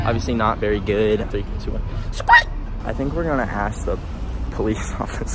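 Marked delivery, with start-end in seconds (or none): 2.1-2.5 极高的尖声音效; 倒数后尖声喊出; squirt sound effect. Pretend. Countdown then squirt effect.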